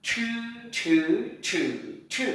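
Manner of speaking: "Chư" with curled tongue and puff of air, four tones; aspirated retroflex ch. Shown as a demonstration. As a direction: curl and burst air on each "chư". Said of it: "C H cong lưỡi bật hơi". (đọc "chư" cong lưỡi, bật hơi)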